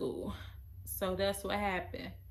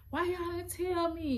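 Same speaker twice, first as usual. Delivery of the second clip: brother yelling; 9 semitones higher than usual. Retold or imitated. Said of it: "yelling at us".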